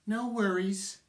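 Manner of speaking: high, singsong "No worries"; mock "No worries". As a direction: say it like a breezy cliché. Mocking the phrase he hates.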